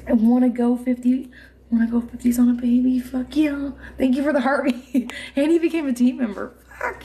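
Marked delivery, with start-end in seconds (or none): none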